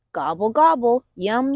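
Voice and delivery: usual speaking voice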